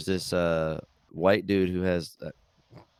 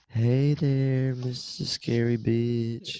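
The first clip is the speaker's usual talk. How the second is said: priest character quote; 3 semitones higher, much slower than usual. Retold or imitated. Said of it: "he's like".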